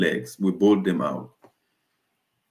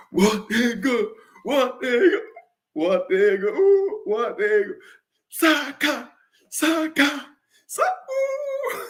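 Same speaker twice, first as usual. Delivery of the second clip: much higher, squealing disbelief; excited squeals. Real, not acted. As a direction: squeak it out in giddy shock. Goal celebration squeals.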